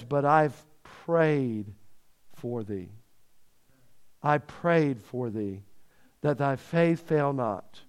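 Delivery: Jesus gently comforting Peter, slow and soft; tender reassurance. Acted. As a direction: hush it like a gentle promise.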